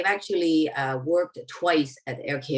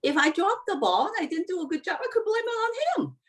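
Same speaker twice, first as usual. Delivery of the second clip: amused disbelief; faster than usual. Retold or imitated. Retelling his own amused reaction.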